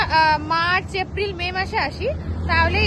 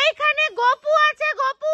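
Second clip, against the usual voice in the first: high, loud, slow calling out; echo shout; 9 semitones higher, 5 dB louder, slower than usual. Real, not acted. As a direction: shout it across the lake for the echo. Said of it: "কি ভালো ইকো হচ্ছে না?".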